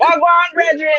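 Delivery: playful Jamaican hello; mock Jamaican greeting. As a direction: greet him like a Jamaican uncle. Joking Jamaican greeting tries.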